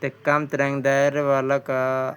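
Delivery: ordinary delivery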